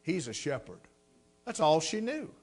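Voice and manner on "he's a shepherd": soft, low-voiced reply of a simple believer; a village woman answering the missionary's wife softly; quiet old woman's answer. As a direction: say it gently and low, with quiet conviction.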